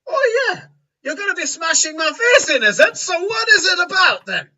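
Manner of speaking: high, loud, belligerent dwarf; angry dwarf. Acted. Grumpy dwarf woken at night bellows at Farewell, high and loud. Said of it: "a dwarf comes out of the nearest door to Farewell and goes".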